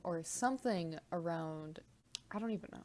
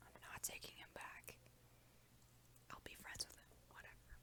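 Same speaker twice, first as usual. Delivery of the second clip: very soft unvoiced whisper; 7 dB quieter, faster than usual. Real, not acted. Telling viewers about tarot readings, in a whisper. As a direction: whisper the whole story confidingly. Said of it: "we're just gonna whisper in this bitch".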